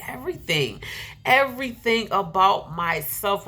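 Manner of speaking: usual speaking voice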